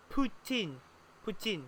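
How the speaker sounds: poutine said poo-tin, short final vowel; French-Canadian pronunciation. Shown as a demonstration. Showing how French speakers say poutine. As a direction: poutine with a short i, not teen. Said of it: "they're saying poutine".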